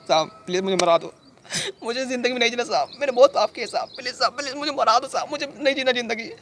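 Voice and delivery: high, anguished begging; desperate pleading. Acted. Guilty man begging sahab to end his life, desperate. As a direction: plead with a wail, pitch climbing and staying up. Begs sahab to have him killed.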